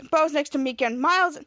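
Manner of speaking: plain reading voice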